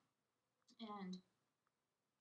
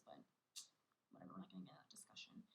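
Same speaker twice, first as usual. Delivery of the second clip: quick, hushed whisper; whispered aside; 10 dB quieter, faster than usual. Real, not acted. Whispering to listeners to drop the argument with her boyfriend. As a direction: brush it off under your breath, fast.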